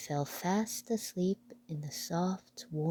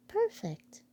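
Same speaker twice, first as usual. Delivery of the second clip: very high, tiny mouse voice; delighted squeak; squeaky mouse voice. Acted. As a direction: pipe it like a pleased little mouse. Mouse character speaks.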